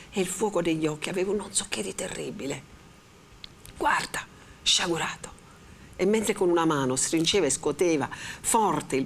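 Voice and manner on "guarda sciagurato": urgent whisper; hushed warning. A narrator's reading. The friar points out the dying around them, whispered. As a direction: breathe it out as a dire warning. Friar shows Renzo the plague.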